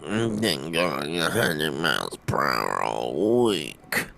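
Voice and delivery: low, slow, tired voice; sleepy voice. Real, not acted. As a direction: talk like you just woke up. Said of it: "You got sleepy voice on".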